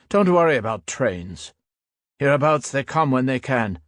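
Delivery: lightly voiced old soldier, casual and amused; a hint of a weary veteran shrugging it off; old soldier, easygoing. A narrator's reading. Old soldier telling the young one not to worry, lightly voiced and casual.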